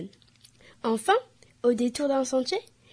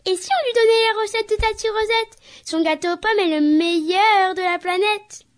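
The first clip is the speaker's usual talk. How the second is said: high, louder eager piglet; little piglet voice; 9 semitones higher, 5 dB louder than usual. Acted. A piglet proposing Tati Rosette's recipe, high eager voice. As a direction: pitch up like an excited little pig.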